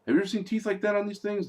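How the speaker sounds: high, fast imitated questioning; quick, high-pitched quoted interrogation; movie character questioning. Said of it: "And the guy said".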